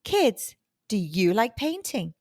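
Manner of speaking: usual speaking voice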